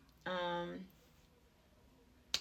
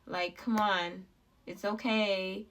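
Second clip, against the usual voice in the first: louder, firm pep talk to herself; self pep talk; 8 dB louder than usual. Retold or imitated. She rallies herself out of depression with a louder pep talk. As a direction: push herself out of the slump. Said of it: "I had to remember".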